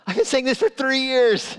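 high, loud mock outburst; exasperated protest. Coach to players who ignored him for years, exasperated. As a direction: burst out in comic indignation, pitch shooting up.

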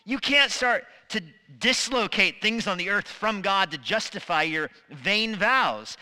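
normal talking voice

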